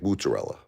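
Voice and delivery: mozzarella with an OO first vowel, "moot-uh-rella"; Brooklyn pronunciation. Shown as a demonstration. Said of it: "I told her".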